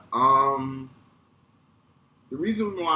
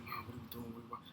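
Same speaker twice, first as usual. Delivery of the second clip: very soft, under-the-breath question; hushed complaint; 19 dB quieter, much faster than usual. Retold or imitated. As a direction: imitate a kid whispering to mom in a cinema. Kid pestering mom during film.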